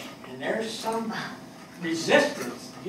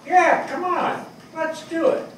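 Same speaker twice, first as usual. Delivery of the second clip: quoting the teacher; 10 semitones higher, 5 dB louder than usual. Retold or imitated. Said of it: "my teacher said".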